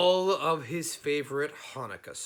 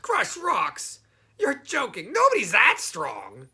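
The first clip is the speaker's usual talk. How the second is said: high, louder goblin scoffing; 9 semitones higher, 11 dB louder than usual. Acted. Goblin scoffing at Herschel's boast, high and loud. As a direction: laugh it off as a goblin.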